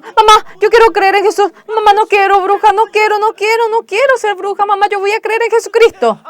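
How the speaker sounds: high, loud frantic begging; desperate crying plea. Retold or imitated. Mison begging her mother, high loud desperate cry.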